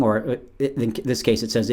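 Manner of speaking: ordinary talk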